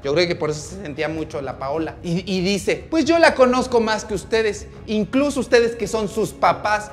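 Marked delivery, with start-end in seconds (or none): 2.9-6.9 high, loud acted tirade; spiteful boasting. Paola taunts Samantha's parents with secrets, spiteful boasting.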